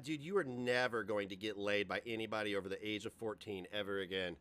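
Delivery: ordinary talk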